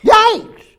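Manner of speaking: high, loud yelp of fright; a sharp startled cry. Acted. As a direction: jump at the sudden voice. Startled by computer voice.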